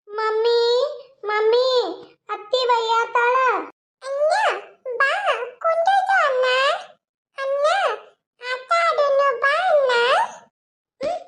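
put-on high child whine, slowly pleading; little girl voice. Little girl whining to mummy that auntie scolds her. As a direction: pester like a small child. Child calling mother, brother.

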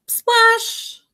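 very high splash with a long hiss; big splash. A narrator's reading. Froggy back with mask on, high splash. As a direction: toss it right up high. Narrated sound of hitting pond.

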